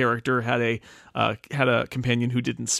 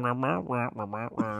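muffled inaudible wah-wah talk; Charlie Brown teacher voice; 2 semitones lower, 9 dB quieter than usual. Retold or imitated. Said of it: "Charlie Brown kind of inaudible".

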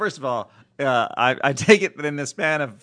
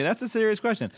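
usual speaking voice

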